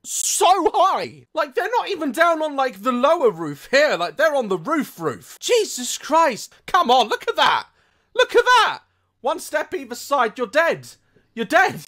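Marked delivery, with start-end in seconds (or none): none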